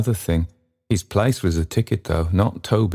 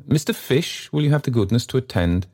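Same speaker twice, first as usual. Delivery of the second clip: high, pompous summons to a secretary; quick, self-important request; stately gentleman, impatient; 7 semitones higher than usual. A narrator's reading.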